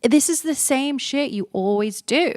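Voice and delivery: fed up with her own habit; exasperated disbelief. Retold or imitated. Scolding herself at his place, high exasperated disbelief. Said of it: "my reaction at the time".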